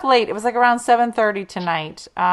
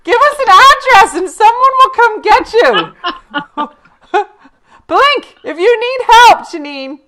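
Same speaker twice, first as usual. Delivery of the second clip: high, loud, laughing mock concern; mock alarm; 13 semitones higher, 9 dB louder than usual. Joking to viewer Janine about no running water, hammed-up alarm. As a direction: play it up like a frantic rescuer.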